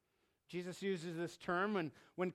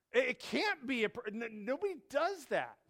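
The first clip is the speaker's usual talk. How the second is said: higher, stammering disbelief; terrified disbelief; 6 semitones higher, 3 dB louder than usual. Acted. Exhausted disciples reacting to the figure, frightened stammer. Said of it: "They were terrified".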